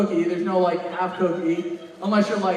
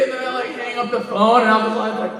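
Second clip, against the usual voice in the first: usual speaking voice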